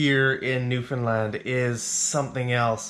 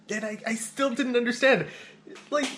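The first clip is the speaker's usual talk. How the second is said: said through a real laugh; higher, laughing at own confusion; amused disbelief, laughing while speaking; 10 semitones higher than usual. Real, not acted. Laughs at failing to understand.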